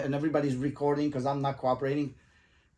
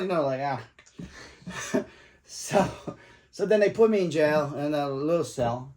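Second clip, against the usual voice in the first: usual speaking voice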